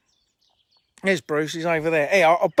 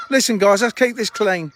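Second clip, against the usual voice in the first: ordinary talk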